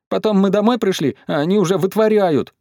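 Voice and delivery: put-on high, plaintive child's voice; sobbing boy. A narrator's reading. Петя tells grandmother about his parents' antics, whimpering. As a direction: complain tearfully, pitched high. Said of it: "всхлипнул Петя".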